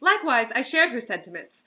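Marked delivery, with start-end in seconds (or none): none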